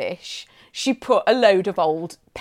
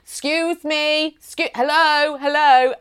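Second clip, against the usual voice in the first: calling through door; 5 semitones higher, slower than usual. Retold or imitated. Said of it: "calling through the door".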